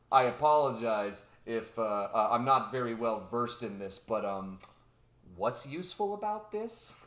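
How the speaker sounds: low, hesitant mock politeness; polite scientist. Scientist questioning the presenter, low and tentative.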